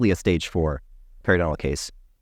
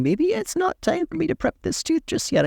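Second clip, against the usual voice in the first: much higher little inner voice; high-pitched voice of a nagging thought; 9 semitones higher than usual. Pretend. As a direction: squeak it like a tiny voice in the head. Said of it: "that little bug in the back of their mind saying".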